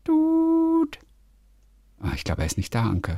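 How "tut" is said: high sung beep like a dial tone; phone ring tone. Shown as a demonstration. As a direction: imitate a phone ringing. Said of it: "ich mache nur Tut".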